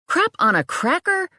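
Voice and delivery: high startled exclamation; startled yelp. A narrator's reading. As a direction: blurt it, caught off guard. Boss startles her in dark.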